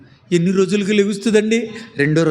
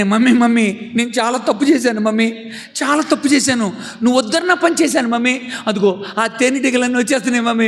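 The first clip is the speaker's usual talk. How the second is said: put-on high, fast pleading child voice; panicked child; 7 semitones higher than usual. Child warning mummy the bees are coming, panicked. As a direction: blurt it out in a rush of fear.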